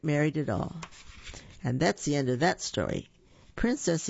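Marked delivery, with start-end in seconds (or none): none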